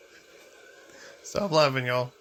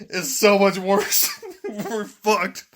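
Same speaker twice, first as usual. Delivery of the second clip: laughing; 4 semitones higher, much slower than usual. Retold or imitated. Laughing through a retold joke.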